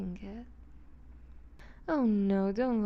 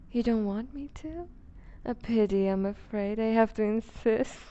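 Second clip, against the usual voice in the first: normal talking voice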